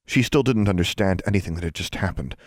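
ordinary delivery

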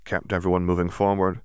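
ordinary delivery

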